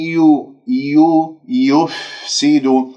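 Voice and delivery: يفسد very slow, lips open on the ي; teacher recitation; recitation demo. Shown as a demonstration. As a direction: model يفسد without rounding. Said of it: "هذا خطأ".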